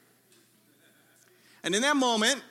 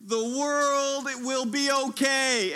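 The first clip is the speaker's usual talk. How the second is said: mock triumph; 6 semitones higher, much slower than usual. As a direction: announce your triumph to everybody. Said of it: "I'm like".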